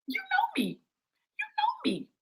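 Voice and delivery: very high, soft little voice; playful acted voice. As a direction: squeak it quietly, far above normal pitch. Acted reply in God's voice.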